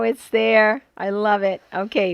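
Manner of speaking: usual speaking voice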